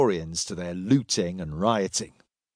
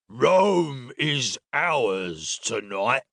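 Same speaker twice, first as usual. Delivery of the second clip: put-on slow drunken boast; drunk soldier; much slower than usual. A narrator's reading. Second soldier boasting to the travellers, slow drunken voice. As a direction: draw the words out like a drunk.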